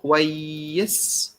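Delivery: "كويس" slow, kwayyis with a long doubled y; "كويس" drawn out, rising at the end; Egyptian Arabic. Shown as a demonstration. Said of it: "you say".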